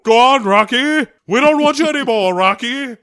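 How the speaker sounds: loud, high mock jeering; barbarian. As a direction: shout him away, fast. Joins the Drago bit.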